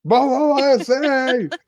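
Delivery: very high, stretched-out name call. Retold or imitated. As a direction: call after someone running off, slowly. Retold chase, calling after her.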